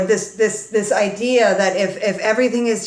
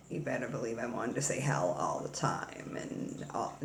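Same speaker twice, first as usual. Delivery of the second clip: whispered naughty confession; 10 semitones lower, 15 dB quieter than usual. Real, not acted. Recalling being forbidden to say hell, whispered. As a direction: breathe it like a secret.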